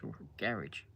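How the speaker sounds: "garage" said GA-ridge, soft and low; British garage. Shown as a demonstration. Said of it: "And garage".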